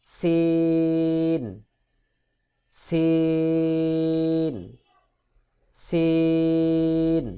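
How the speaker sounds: সিন with hissing s and very long held vowel; letter demo. Shown as a demonstration. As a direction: stretch সিন slowly on one level note. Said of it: "সিন উচ্চারণ হবে". (নিচের দাঁতে জিহ্বা লাগিয়ে লম্বা টানে সিন)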